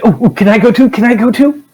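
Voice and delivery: high, excited begging to tag along; eager pleading. Acted. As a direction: plead like an overexcited tagalong, pitch climbing. GM acts Arborist begging to come.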